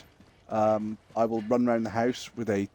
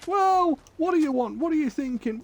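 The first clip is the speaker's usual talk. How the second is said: high, light imitation of a partner asking; mock partner voice; 13 semitones higher than usual. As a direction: ask like a curious partner, playfully.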